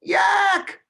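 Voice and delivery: very high, loud yelp; shrieked animal cry; yelling yak. A narrator's reading. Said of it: "The yaks were yelling".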